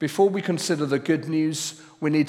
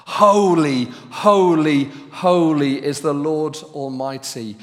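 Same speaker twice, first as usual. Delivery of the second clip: each HOLY called out much louder; emphatic holy; 11 dB louder, slower than usual. Shown as a demonstration. Preacher showing the seraphim's emphasis, loud and emphatic. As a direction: proclaim it like capital letters, full voice. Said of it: "they're repeating it for emphasis".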